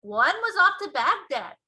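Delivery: high, loud giant's announcement; BFG. A narrator's reading. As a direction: tell the Queen eagerly.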